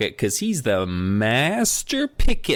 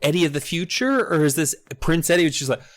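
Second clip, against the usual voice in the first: ordinary talk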